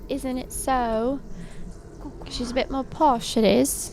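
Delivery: slow, prim posh English; prissy, slow "Isn't it so?"; posh Hermione. Retold or imitated. Copying how Hermione would talk to friends: slow posh English. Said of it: "She would just say".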